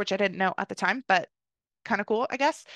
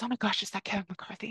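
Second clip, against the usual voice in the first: whisper; 6 dB quieter than usual. Retold or imitated. Stranger asks quietly about celebrity.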